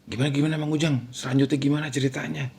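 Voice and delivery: acted curious neighbours, hurried and hushed. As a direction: press eagerly for the rest of the story. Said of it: "Warga kan penasaran ya".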